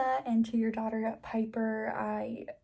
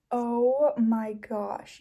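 usual speaking voice

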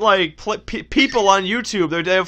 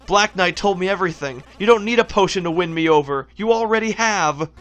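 ordinary talk, nothing marked

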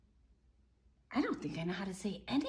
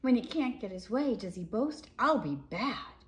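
lively, sing-song picture-book reading; reading to children; 4 semitones higher, 7 dB louder than usual. A narrator's reading. Reading a dinosaur book to children, lively storybook voice. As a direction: read it out like a bedtime story.

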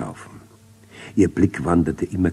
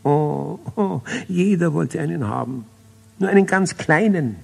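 wailing; 11 semitones higher than usual. A narrator's reading. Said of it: "Melchior und Balthasar heulten los".

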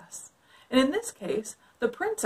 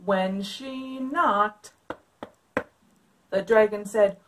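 put-on lively read-aloud for kids; story narration; 6 dB louder than usual. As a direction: read it like a picture book. Reads picture book aloud.

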